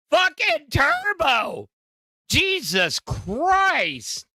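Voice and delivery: high, slow, drawn-out cry of disbelief; exasperated outburst. Real, not acted. Host reacting to Turbo drama, drawn-out high exasperated cry. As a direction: throw your hands up at the name.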